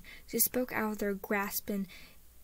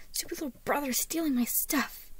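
higher-pitched irritated grumble; annoyed growl; 5 semitones higher than usual. A narrator's reading. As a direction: complain crossly about a pesky brother. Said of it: "Harriet growled".

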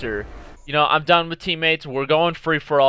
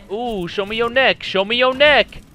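rising taunt; 6 semitones higher than usual. Real, not acted. Taunting enemy, rising excitement.

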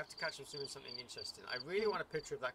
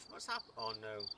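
ordinary talk, nothing marked

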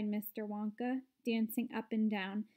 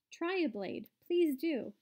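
higher-pitched eager invitation; eager urging; 5 semitones higher than usual. A narrator's reading. Wonka urges the children to try the grass, eager urging. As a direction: urge them to have a taste.

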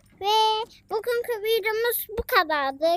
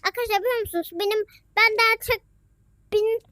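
ordinary talk

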